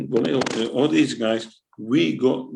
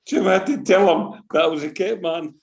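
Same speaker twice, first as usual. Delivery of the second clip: usual speaking voice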